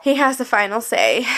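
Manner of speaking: ordinary delivery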